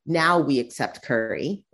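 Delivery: normal talking voice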